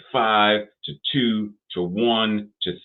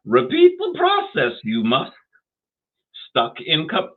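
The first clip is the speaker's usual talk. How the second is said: much higher, squeezed Yoda voice with backwards wording; Yoda impression; 8 semitones higher, 4 dB louder than usual. Host playfully quoting Yoda to listeners, high Yoda voice. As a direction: channel Yoda, pinched and high. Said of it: "my Yoda impersonation".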